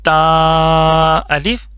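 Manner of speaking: drawn-out syllable reading, slow and clear; slow teacher demo. Shown as a demonstration. Teacher reciting the letter for students, slow and drawn out. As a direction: model the letter name slowly for the class. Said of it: "یہ بھی ہم نے پڑھا ہوا ہے".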